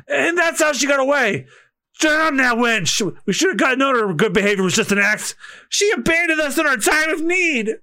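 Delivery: high, aggrieved character voice; indignant crewman. Acted. Crewman telling how the thief got away, indignant.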